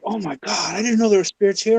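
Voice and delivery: high, hurried mock alarm; neighbor tripping out. Neighbor learning there are spirits here, imitated as tripping out. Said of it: "she starts tripping out".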